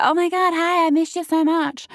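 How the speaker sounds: high, excited, gushing greeting; gushing girl. Retold or imitated. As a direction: squeal it like she's thrilled.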